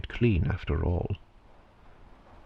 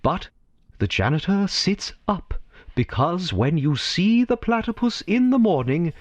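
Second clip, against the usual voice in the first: narration lifting higher as the dream appears; rising storyteller wonder; 6 semitones higher than usual. A narrator's reading. Narrator drawing listeners into the janitor's dream, rising in wonder. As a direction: let the story swell into awe.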